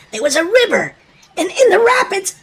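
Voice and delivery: usual speaking voice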